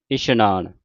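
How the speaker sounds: ਇਸ਼ਨਾਨ with sh, 'ishnaan'; dotted-sassa pronunciation. Retold or imitated. Teacher quotes how some congregations recite, ਇਸ਼ਨਾਨ with sh. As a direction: say ਇਸ਼ਨਾਨ with a hushing sh. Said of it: "ਸੱਸੇ ਦੇ ਪੈਰ ਵਿੱਚ ਬਿੰਦੀ ਲਾ ਕੇ".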